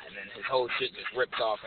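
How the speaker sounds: ordinary talk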